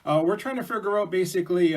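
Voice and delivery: high, eager wolf voice; wolves asking help. Acted. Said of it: "there were some wolves".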